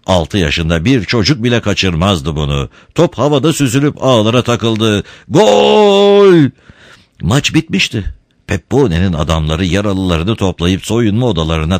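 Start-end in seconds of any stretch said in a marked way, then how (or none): 5.3-6.3 high, loud triumphant shout of goal; goal cry. A narrator's reading. Narrator cheering the winning goal in a loud, high shout. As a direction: call the goal like a stadium crowd.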